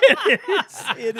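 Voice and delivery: loud high laugh into words. Real, not acted. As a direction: crack up loudly. Laughing about TikTok prank.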